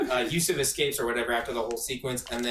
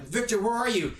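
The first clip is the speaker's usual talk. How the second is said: pitched-up anxious call; high worried call; 9 semitones higher than usual. Retold or imitated. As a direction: call out for a friend. Quoting friend calling.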